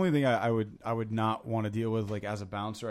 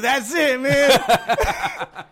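put-on high, loud dopey drunk voice, half laughing; drunk guy; 12 semitones higher, 10 dB louder, much slower than usual. Drunk patron telling the DJ, high goofy drunk voice. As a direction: play the pleased drunk, pitched way up.

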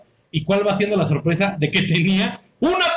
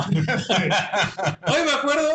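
laughing; much slower than usual. Real, not acted. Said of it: "me río".

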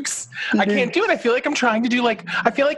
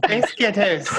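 bad Scottish accent; 2 semitones higher than usual. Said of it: "I'm horrible at all of it".

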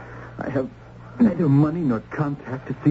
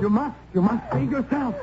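urgent plea; 11 semitones higher than usual. Acted. Urging her to flee now.

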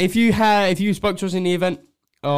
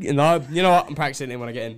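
usual speaking voice

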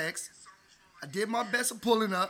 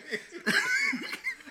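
high-pitched cracking up; helpless high laugh; real laughter. Real, not acted. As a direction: crack up at a silly name. Cracking up at the nickname.

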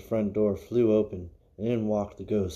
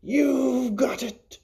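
very high, slow, shrieked scream; jump scare scream; 4 dB louder, much slower than usual. Shown as a demonstration. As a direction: leap at the listener and shriek it. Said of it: "jump at somebody in the audience and scream".

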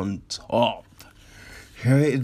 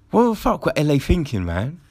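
ordinary delivery

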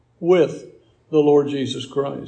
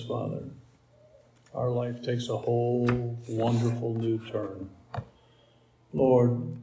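softer, lower praying voice; closing prayer; 4 semitones lower, 5 dB quieter than usual. Real, not acted. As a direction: lead the congregation gently in earnest prayer.

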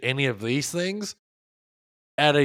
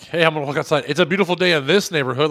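loud, high, quick show-off delivery; hammed-up jerk announcing it to the street, "this" pushed; mock obnoxious jerk; 6 semitones higher, 8 dB louder, faster than usual. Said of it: "that sounds like you're an asshole".

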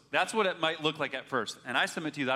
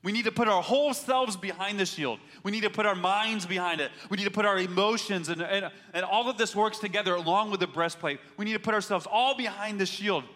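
louder, higher preaching push; full-force preaching; 5 semitones higher, 4 dB louder than usual. Acted. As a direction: drive it home from the pulpit.

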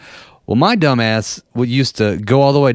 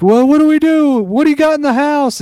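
panicked relatives; 4 dB louder than usual. Retold or imitated. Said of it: "they're like".

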